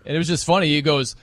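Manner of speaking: normal talking voice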